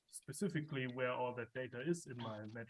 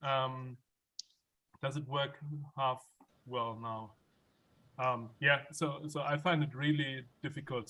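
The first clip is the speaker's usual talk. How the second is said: speaking louder; 7 dB louder than usual. Real, not acted. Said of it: "I just tried to scream a little louder".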